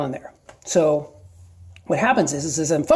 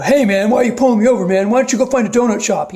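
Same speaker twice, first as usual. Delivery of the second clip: louder, higher, fast mouthing off to a cop; mock snarky driver; 5 semitones higher, 6 dB louder, faster than usual. Driver pulled over mouths off to the officer, hammed-up snark. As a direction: play the rude motorist picking a fight, quick and loud. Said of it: "having a bunch of attitude".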